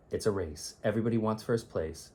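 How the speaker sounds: plain reading voice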